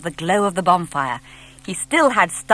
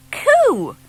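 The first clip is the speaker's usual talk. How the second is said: much higher, louder startled coo; surprised; 6 dB louder than usual. Acted. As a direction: jump at the sudden thud. Said the boy on the log.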